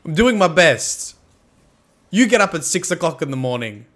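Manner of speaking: mock yelling. Streamer scolds chat for getting angry with him, mock yelling. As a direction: yell at them in mock indignation. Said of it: "I'm sorry for yelling".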